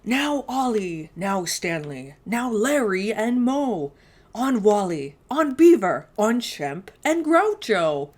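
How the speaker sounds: slow, loud farmer's call; booming roll call of names. Acted. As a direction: call each turkey across the farm. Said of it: "He whistled and shouted and called them by name".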